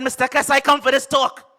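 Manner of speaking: loud, high charge at Kes; fired-up confrontation. Acted. Poet storming up to Kes, loud and high. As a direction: storm in shouting.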